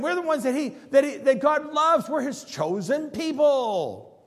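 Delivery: loud, high, self-satisfied proclamation; smug boast. Acted. Preacher mimicking Israel's proud boast, loud and high smug. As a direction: crow it like you're untouchable.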